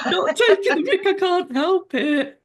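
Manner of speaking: high, loud self-quote with an Australian twang; mock-protest in a playful Australian accent; imitated Australian accent. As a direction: plead innocence in an Australian accent, laughing. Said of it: "I picked up an Australian accent and it's like".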